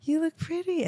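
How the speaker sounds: high, soft cooing to an infant; sweet baby talk. Retold or imitated. Grandma imitating Jenna cooing to her baby while brushing her hair, soft high baby talk. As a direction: say it like a mom adoring her baby in the mirror.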